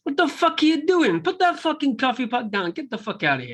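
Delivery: hammed-up high-pitched movie rant, easing down at the end; angry mobster impression. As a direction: play an exasperated gangster giving orders. Acted quote of angry mobster.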